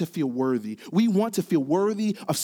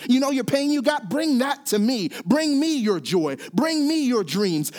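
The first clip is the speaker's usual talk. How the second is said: impassioned preaching; 6 semitones higher than usual. Acted. Preacher voicing God's call to the congregation, fervent. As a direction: build it like an altar call.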